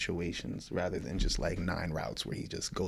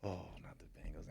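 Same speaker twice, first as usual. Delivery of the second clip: softly whispered mock horror; whispered dismay; 2 semitones lower, 14 dB quieter, faster than usual. Real, not acted. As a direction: breathe it out in dismay.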